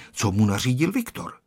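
usual speaking voice

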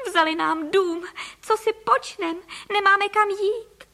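high, wailing lament; sobbing. A narrator's reading. Wife with nowhere to go, sobbing. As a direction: lament it in tearful high pitch.

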